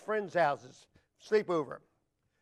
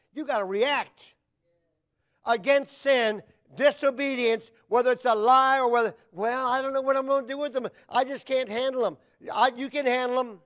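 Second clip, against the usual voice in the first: raised, emphatic preacher's voice; forceful preaching; 5 semitones higher, 10 dB louder than usual. Real, not acted. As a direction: press the charge on the congregation.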